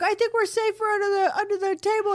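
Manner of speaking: high, quick whiny protest. Retold or imitated. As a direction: whine it like a scared kid. Acting out family protesting.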